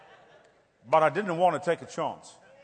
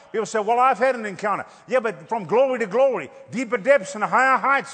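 preaching at full force; 2 semitones higher, 4 dB louder than usual. Acted. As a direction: preach it out, building. Preaching rising.

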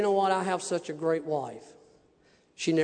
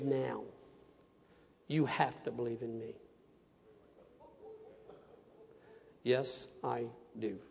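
hushed; 7 semitones lower, 11 dB quieter than usual. Real, not acted. Hushed moment of the story.

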